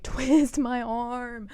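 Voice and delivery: drawn out slowly, pretending to be forced; a slow, teasing "poor me"; mock reluctance. Imagined reply to someone urging marriage, mock reluctant. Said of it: "I'd be like".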